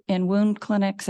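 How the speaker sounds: normal talking voice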